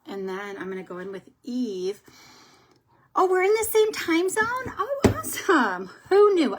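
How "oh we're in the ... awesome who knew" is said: high, loud delighted exclamation; excited surprise. Real, not acted. Vlogger learns viewer shares her time zone, excited surprise. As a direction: exclaim it with a real jump up in pitch.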